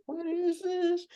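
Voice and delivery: high, drawn-out wary question; mock suspicion. Imagined wary onlooker questioning the cult.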